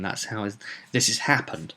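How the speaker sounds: ordinary talk, nothing marked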